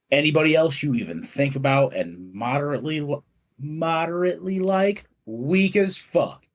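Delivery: teacher's rant. Acted. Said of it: "poem I wrote called Teacher's Rant".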